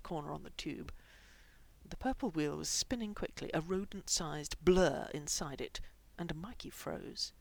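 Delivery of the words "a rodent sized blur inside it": plain reading voice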